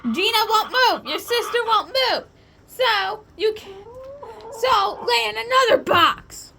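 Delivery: panicked. Real, not acted. Panicking about the sister.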